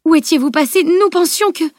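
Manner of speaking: high, flustered, breaking off unfinished; agitated questioning. A narrator's reading. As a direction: demand answers in shock. Stunned mother questions runaway daughter.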